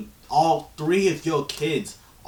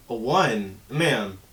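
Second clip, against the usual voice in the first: ordinary delivery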